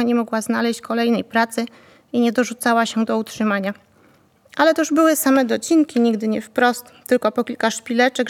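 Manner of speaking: usual speaking voice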